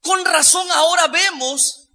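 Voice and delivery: high, loud, ringing pulpit voice; full-force preaching. Real, not acted.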